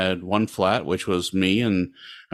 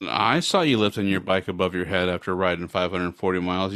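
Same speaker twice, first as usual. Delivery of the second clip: ordinary talk, nothing marked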